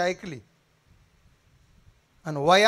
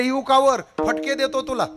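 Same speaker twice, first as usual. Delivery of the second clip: threatening shout; 7 semitones higher, 7 dB louder than usual. Acted. Villager threatening boy on top.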